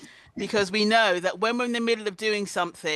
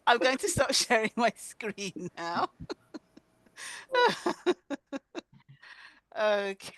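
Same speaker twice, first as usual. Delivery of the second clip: normal talking voice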